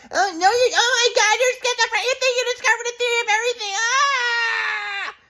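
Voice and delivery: very high mock mom voice, breaking into a wail; high mock mom ending in a wailing yell; wailing mom impression. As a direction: mimic mom crying out high and fast. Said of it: "this is actually how she sounded, but worse".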